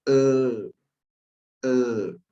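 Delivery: "E" said twice, clear and held; vowel demo. Shown as a demonstration. Teacher showing how O with diéresis sounds. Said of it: "la O con diéresis es".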